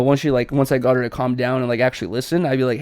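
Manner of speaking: ordinary delivery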